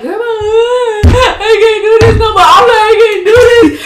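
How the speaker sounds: crying plea. Retold or imitated. Begging grandma mid-labor, loudly crying that she can't push. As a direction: sob it out, begging, at the end of your strength. Retelling breakdown during labor pushing.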